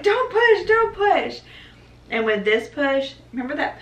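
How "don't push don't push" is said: much higher, frantic husband pleading; panicked husband. Retold or imitated. Wife imitating her husband begging her not to push yet.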